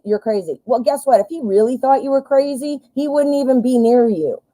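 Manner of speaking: ordinary delivery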